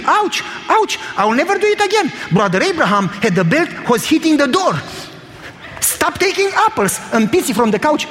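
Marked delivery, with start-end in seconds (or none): 0.0-2.1 high, hammed-up cries of pain; fake screaming. Pizzi on the couch faking punishment, hammed-up high scream. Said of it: "smiling and screaming". 5.8-7.0 mock stern. Father faking a belt beating.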